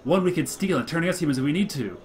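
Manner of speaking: loud, high, urgent rant about fruitcake; zealous monster orator. Acted. Mopsy warning the elders, high and loud. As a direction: whip up the crowd in alarm. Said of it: "Mopsy goes on".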